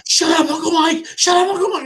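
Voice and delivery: high, loud mock teenage protest; mortified nephew. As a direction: play a teenager begging the uncle to stop. Mimics mortified nephew snapping back.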